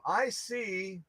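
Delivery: ordinary talk, nothing marked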